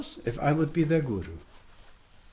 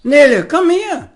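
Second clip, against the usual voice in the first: calling the dog; 10 semitones higher, 9 dB louder than usual. Real, not acted.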